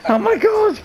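very high-pitched cry of alarm; panicked squeal. Real, not acted. Player reacts to being caught, panicked squeal. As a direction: squeal it in fright. Player startled in horror game.

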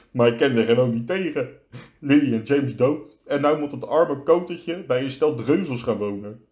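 gespeeld huilerige klaagstem; snikkend. A narrator's reading. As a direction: jammer het uit als een snikkende reus. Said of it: "snikte Hagrid". (licht aangezet snikkend klagen)

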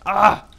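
short loud high yelp; startled cry of ah; a loud high scream of alarm; sharp scream. Real, not acted. Sudden hit in game.

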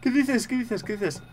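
high, frightened babble easing off in volume; real fright, pitch sliding down with each repeat; panicked repeating. Real, not acted. Still reeling from the scare.

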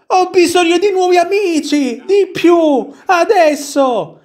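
very high, shrieking tantrum voice; prince's cry. Acted. Prince demanding new friends, very high acted scream. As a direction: cry out demands far above your normal pitch. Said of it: "Il principe gridò".

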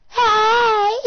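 high, loud hey; mock shouted greeting. Calling to a little lady, high playful shout. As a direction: holler a high-pitched greeting.

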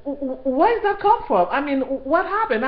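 high stammering disbelief, tripping over the w; stammering shock. Retold or imitated. Reacting to hearing she was called nasty, high stunned stammer. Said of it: "'Cause I'm like".

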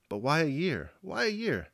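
rising, dismayed repeat; sudden dismay. A narrator's reading. Natasha stunned by the year's delay, sudden dismay. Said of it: "Natasha repeated suddenly".

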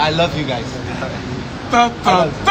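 ordinary talk

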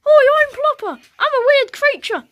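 high squeaky creature voice, loud; high and loud goofy monster. Pretend. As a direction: play the silly Plopper creature. Kids voicing the Plopper creature.

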